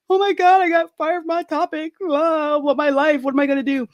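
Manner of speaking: high wailing despair, played for laughs; a hammed-up teenage wail; mock bawling. Telling mom she got fired, hammed-up wail. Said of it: "I'm bawling".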